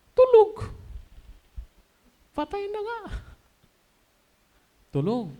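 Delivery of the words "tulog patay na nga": high, odd scoffing voice; mocking crowd. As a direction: sneer back at him, mocking. Mourners scoff at Jesus.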